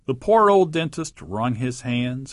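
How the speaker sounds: usual speaking voice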